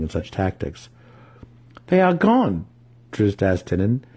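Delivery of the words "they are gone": high, desperate question. Acted. Drizzt asking his brother about the gnomes, desperate high question. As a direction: voice on the edge of desperation. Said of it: "his voice on the edge of desperation".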